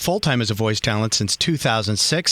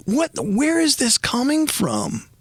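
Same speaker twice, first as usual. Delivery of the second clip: baffled question; 10 semitones higher, slower than usual. Retold or imitated. Telling his wife about the dream.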